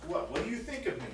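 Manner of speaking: indignant what, do you think that of me; mock-indignant gasp of hurt pride; offended disbelief. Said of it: "my immediate reaction is like".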